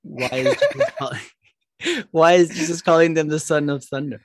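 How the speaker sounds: laughing through the question; giggle, then get the question out; amused. Real, not acted. Listener laughs while asking question.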